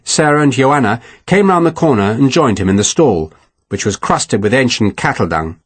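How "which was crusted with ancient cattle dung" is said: ordinary delivery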